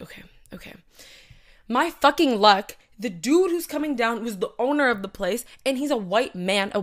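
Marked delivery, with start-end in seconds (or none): 0.0-0.7 meek, breathy whispered okay, okay. Retold or imitated. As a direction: whisper it, hushed and obedient. Meekly agreeing to the guard.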